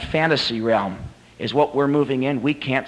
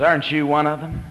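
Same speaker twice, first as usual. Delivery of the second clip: understated guard. Acted. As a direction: ask it evenly, as the guard. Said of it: "The guard comes over".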